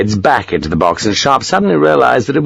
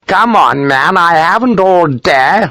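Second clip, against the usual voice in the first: high, drawn-out impatient complaint; impatient colonel; 8 semitones higher, slower than usual. A narrator's reading. Colonel hurrying a nervous doctor, impatient. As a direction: hurry him along in a raised, pompous pitch.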